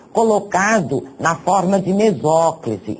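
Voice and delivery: normal talking voice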